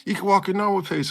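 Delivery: put-on high, fast, mildly annoyed girl's voice; snippy little lecture, lightly voiced.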